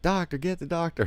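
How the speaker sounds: high frantic call for the doctor; panicked henchmen. Retold or imitated. Henchmen crying out after their boss is shot, high and frantic. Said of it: "all of his little henchmen are like".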